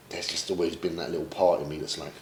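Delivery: usual speaking voice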